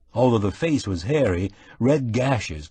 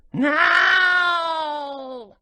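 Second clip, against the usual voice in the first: very high, shrieking cat cry; piercing shriek. Acted. The trapped cat answers from the closet, shrieking. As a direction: let the cat wail it out. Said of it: "came a piercing shriek".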